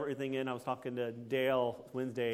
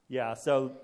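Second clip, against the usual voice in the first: ordinary talk, nothing marked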